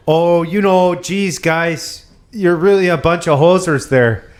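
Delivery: hammed-up hoser Canadian voice; Canadian accent. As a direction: friend mocks a Canadian buddy, hammed-up Canadian accent. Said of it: "sounds a lot more like a Yooper".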